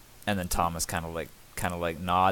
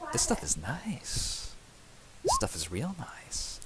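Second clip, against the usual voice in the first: slow, soft, higher murmur of wonder; awed hush; 9 semitones higher, 8 dB quieter, slower than usual. Acted. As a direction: breathe it out in awe. Smith marvels at wire.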